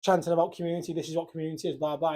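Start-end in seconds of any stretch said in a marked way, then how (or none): none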